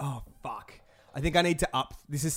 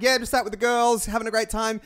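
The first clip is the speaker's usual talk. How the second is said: mock breezy wife; 6 semitones higher, 4 dB louder than usual. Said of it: "I think she'll be like".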